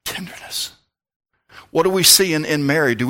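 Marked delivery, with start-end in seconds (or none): 0.1-0.7 whispered, tender and hushed. Acted. Preacher whispering the word to the congregation, hushed. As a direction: breathe the word like a secret. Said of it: "whispered word".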